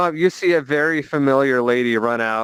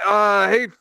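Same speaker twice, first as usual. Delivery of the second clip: awkward innkeeper; 7 semitones higher, much slower than usual. Acted.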